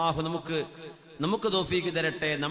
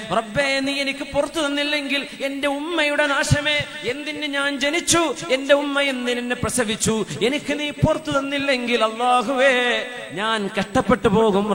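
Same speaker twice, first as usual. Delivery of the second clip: pleading lament; 6 semitones higher than usual. Acted. Preacher voicing Umar's lament.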